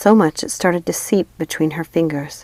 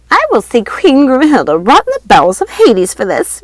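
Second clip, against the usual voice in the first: high, loud furious witch scream; 7 semitones higher, 8 dB louder than usual. A narrator's reading. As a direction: scream the curse at her. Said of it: "Ruby screamed".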